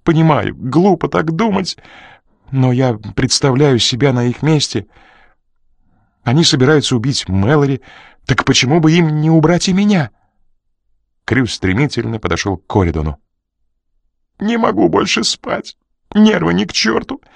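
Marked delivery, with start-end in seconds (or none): 0.0-10.1 higher, faster anxious pleading; anxious Crew. Acted. Crew fearing for his life, anxious and rushed. 14.4-17.2 high, breathless complaint; complaining, gasping. Acted. Crew complaining to Coridon, out of breath. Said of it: "пожаловался он, судорожно дыша".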